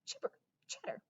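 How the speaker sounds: breathy, mostly whispered squirrel chatter; hissy squirrel squawk; squirrel screech. Acted. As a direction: chitter like an evil squirrel. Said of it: "he screeches".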